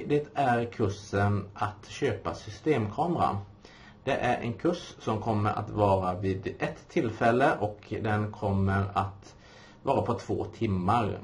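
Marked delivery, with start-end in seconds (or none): none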